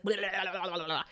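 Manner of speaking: rapid rubbery blubbering noise; low, loose-tongued wobble sound; imitated wobbling noise. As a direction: burble a run of flapping blahs. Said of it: "everything's just like".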